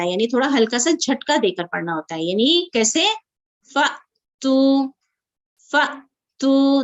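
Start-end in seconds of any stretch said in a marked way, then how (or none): 3.7-6.8 फ़तू very slow, with a small stop before तू; tiny jolt in the middle of फ़तू; slight catch on hamza. Shown as a demonstration. Teacher shows students the stop at hamza sakina. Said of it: "कैसे रुक रही हूँ".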